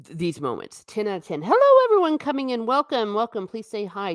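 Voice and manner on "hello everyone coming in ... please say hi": much higher, louder, quick hello to the chat; cheery greeting. Real, not acted. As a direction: brighten up to welcome newcomers. Greeting live chat viewers.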